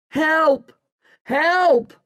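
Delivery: very high cry for help; shouting. A narrator's reading. Boy struggling to hold the smugglers, shouting. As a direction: call for rescue, pitched right up. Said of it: "I shout".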